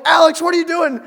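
loud, high-pitched disbelieving yell; acted alarmed shout. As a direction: shout at a friend who should be up. Waking a sleeping friend in story.

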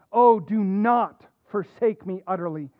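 normal talking voice

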